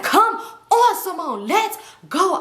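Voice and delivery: high-pitched, drawn-out summons; impatient. Retold or imitated. Friend wants to leave, imitated impatient call. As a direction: imitate a fed-up friend calling you away.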